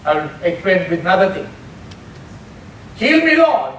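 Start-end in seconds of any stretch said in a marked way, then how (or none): 3.0-3.8 a hint of a plea for healing, pitched higher; pleading prayer. Shown as a demonstration. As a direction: ask God to heal you, lightly. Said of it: "that is petition of prayer".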